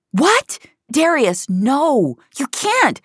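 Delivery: high, urgent objection; alarmed protest. A narrator's reading. Finch protests as father takes Mia away, high and alarmed. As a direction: protest in shock, pitch jumping up.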